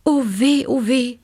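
storybook cry of alarm; dismayed call. A narrator's reading. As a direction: wail in worry for the child. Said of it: "rief der Marienkäfer Papa aus dem Hintergrund".

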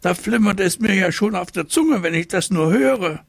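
high, eager tiger voice; bubbling over with craving; lightly high-pitched little tiger; excited little tiger. A narrator's reading. Tiger raves about cake.

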